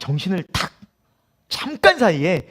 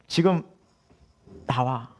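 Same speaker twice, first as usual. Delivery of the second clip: normal talking voice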